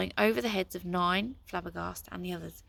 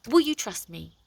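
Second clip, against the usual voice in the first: pleading; 3 semitones higher, 8 dB louder than usual. A narrator's reading. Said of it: "Nine pleaded".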